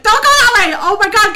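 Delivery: high, very loud yelling; panicked shout. Real, not acted. Player to gnomes going astray, panicked shout. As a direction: scream after them in alarm.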